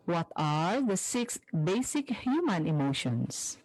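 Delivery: usual speaking voice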